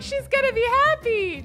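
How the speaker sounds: high, loud mock squeal; gleeful prediction. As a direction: exclaim it high with hopeful glee. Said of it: "I was like".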